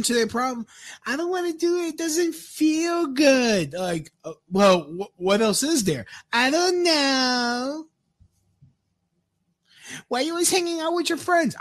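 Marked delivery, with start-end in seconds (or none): 1.1-3.7 mocking a woman who won't fix her problem, high whiny voice. Mocking women's complaints. 6.3-7.9 mock whiny woman. Mocking woman's answer. 10.1-11.6 high, fast nagging mock voice; parodying a partner nagging about his friends, high and fast.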